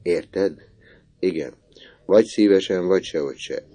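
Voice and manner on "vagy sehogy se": ordinary delivery, nothing marked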